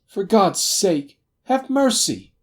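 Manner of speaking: high, loud, drawn-out shriek; desperate high-pitched wail; shrieked plea. A narrator's reading. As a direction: beg for mercy in terror. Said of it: "he shrieked".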